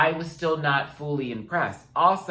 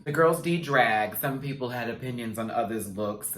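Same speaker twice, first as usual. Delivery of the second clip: ordinary talk, nothing marked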